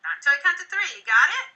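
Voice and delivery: slow, calm and soothing. Shown as a demonstration. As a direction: settle the kids down gently. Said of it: "I want to kind of, um, reset".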